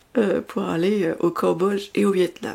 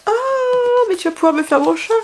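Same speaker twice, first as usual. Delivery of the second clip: put-on higher, quick child-like begging; niece's eager plea; 12 semitones higher, faster than usual. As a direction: imitate the little niece pleading fast. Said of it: "m'a dit".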